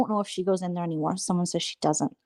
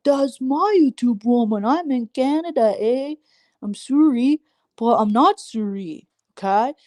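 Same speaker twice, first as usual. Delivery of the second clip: mock Canadian; 5 semitones higher, slower than usual. Zeus voiced with Canadian eh.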